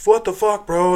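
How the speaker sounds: high, loud mimicked protest; angry. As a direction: imitate an indignant wedding guest. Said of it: "angry at me".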